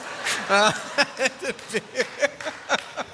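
amused chuckle at the crowd; real laugh. Real, not acted. Pastor laughs when congregation says false, amused chuckle. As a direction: laugh at their answer.

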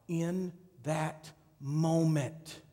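very slow, word by word; drawn-out preacher's emphasis. Real, not acted. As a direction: stretch and separate each word to drive it home. Preacher drives the key phrase home.

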